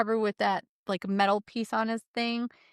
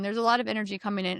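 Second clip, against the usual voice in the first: ordinary talk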